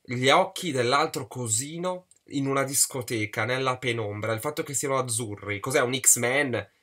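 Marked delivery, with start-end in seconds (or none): none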